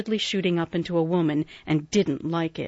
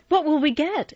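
exclaiming; 10 semitones higher, 3 dB louder than usual. A narrator's reading. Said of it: "exclaimed Jo".

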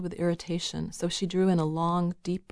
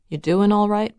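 sudden loud, higher-pitched question; abrupt; 4 semitones higher, 9 dB louder than usual. A narrator's reading. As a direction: fire it out to startle the listener. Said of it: "so abruptly that Lisa jumped".

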